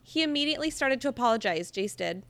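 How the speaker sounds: usual speaking voice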